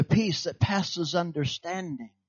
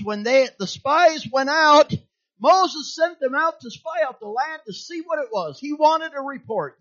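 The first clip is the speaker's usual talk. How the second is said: higher, preaching at full tilt; 10 semitones higher, 5 dB louder than usual. Acted. Preacher telling Numbers spies story, preaching.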